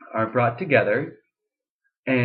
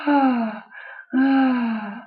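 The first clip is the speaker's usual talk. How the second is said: much higher, airy ah; breathy falsetto sigh, much higher; 13 semitones higher, 3 dB louder than usual. Shown as a demonstration. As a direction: float a light disconnected falsetto. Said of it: "breathy, disconnected sound".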